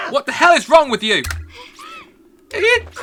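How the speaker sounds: put-on a furious friend yelling at the girl with scissors. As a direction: scream at her for stabbing him.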